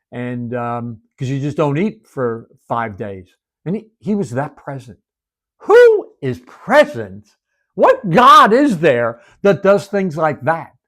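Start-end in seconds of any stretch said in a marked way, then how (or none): 5.6-10.7 high, loud and slow proclamation; preaching climax. Real, not acted. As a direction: build to the peak of the sermon, slowly.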